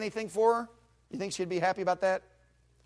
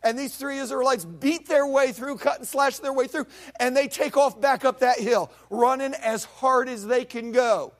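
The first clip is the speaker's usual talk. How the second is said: loud, high storytelling sermon; fiery preaching; 7 semitones higher, 7 dB louder than usual. Acted. Preacher thundering the battle story to his congregation. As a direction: preach the battle at full force.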